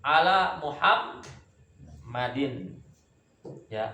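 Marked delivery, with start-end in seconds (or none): 0.0-2.8 Muhammadin slow with a held double m; slow Ala Muhammadin, double m held; doubled mim. Shown as a demonstration. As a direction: stretch the mm of Muhammadin. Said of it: "Mimnya yang bertasdid".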